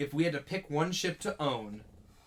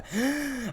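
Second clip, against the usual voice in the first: sharp gasp; 9 semitones higher than usual. Pretend. Auctioneer bit, gasp.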